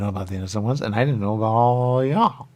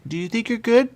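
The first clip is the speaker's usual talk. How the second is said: high mock interrogation; 12 semitones higher, 5 dB louder than usual. Questioning suspect in character.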